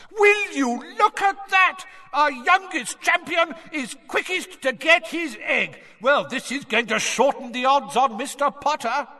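high-pitched excited shouting; yelling. A narrator's reading. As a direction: bellow like a sports announcer. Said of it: "Bagman was yelling".

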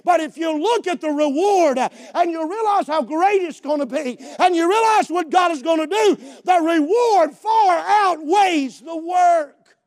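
building exhortation; full-force preaching. Real, not acted. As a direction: preach it out loud and high to the congregation.

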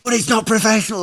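lightly voiced baffled boss protesting; imitating the confused manager. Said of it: "he just went".

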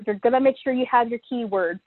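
ordinary talk, nothing marked

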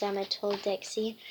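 usual speaking voice

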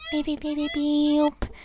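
high electronic-style beeping; high mock siren beeps; imitated alarm beeps. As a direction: beep like a warning alarm.

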